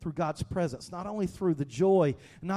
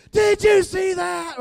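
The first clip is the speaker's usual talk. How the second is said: slow, much higher, shouted disbelief; excited shout; 13 semitones higher, 10 dB louder, slower than usual. Retold or imitated. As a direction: yell it down the phone at a friend. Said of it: "we started calling people".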